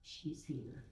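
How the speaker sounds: hushed confiding whisper. Real, not acted. Speaker whispering to the congregation about someone present. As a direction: whisper so she can't hear. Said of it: "I'm gonna whisper this".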